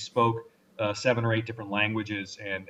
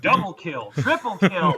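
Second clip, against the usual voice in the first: loud, high mock announcer callouts; game announcer; 8 semitones higher, 6 dB louder, slower than usual. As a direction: call out the kills like a game announcer. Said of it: "they've got an announcer in the background".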